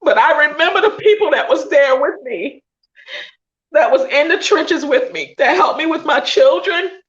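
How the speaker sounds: high voice, fighting back tears; real crying pushing the pitch up; tearful. Real, not acted. Host remembering people who stood by her, tearful. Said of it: "I'm trying not to cry now".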